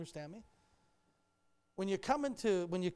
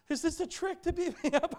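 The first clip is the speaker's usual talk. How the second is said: suspicious fear; 11 semitones higher, 8 dB louder than usual. Retold or imitated. Suspicious kid questions preacher.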